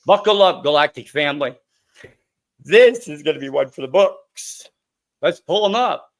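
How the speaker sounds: loud, punchy show kickoff; rally the audience for a big show; hyped-up host. Real, not acted. Host hypes up listeners before interview.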